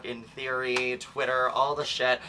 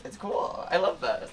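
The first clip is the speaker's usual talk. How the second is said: usual speaking voice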